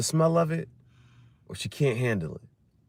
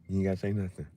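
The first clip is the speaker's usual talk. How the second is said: ordinary delivery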